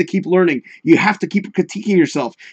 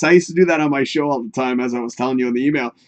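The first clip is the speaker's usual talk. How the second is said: ordinary talk, nothing marked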